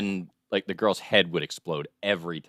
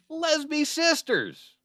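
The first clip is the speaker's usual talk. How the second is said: dramatic announcement; slower than usual. Acted. Host announcing story title dramatically.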